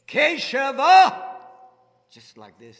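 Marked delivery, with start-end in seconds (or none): 0.1-1.4 a sudden booming call of the name, slow and high; loud call. Retold or imitated. Voice booming from behind calls the name. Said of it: "a very loud voice back here".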